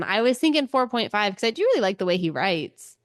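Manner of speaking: ordinary talk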